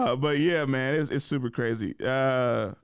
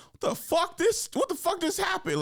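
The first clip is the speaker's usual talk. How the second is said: high-pitched incredulous mock outburst; baffled disbelief. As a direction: act out total bafflement, pitched way up.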